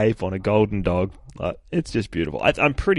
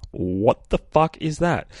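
slow, higher, stunned "what the fuck is that"; shocked question; 6 semitones higher, slower than usual. Retold or imitated. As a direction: read it like you can't believe your eyes. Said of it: "next line on the next page is".

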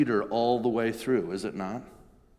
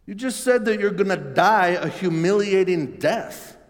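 higher, louder disbelieving outcry; loud, higher voice of shocked disbelief; incredulous protest; 9 semitones higher, 8 dB louder than usual. Acted. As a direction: push back hard, aghast. Peter protesting Jesus' plan.

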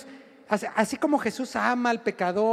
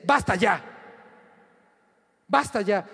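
stern rebuke; 3 semitones lower than usual. Retold or imitated. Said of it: "Jesús se pone un poquito más duro".

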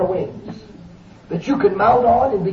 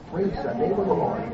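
ordinary talk, nothing marked

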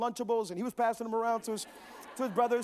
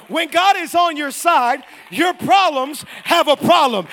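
loud, slow preacher's proclamation; emphatic louder repeat; 4 semitones higher, 13 dB louder, slower than usual. Real, not acted. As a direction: drive it home so they hear you this time. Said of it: "I don't think you heard me".

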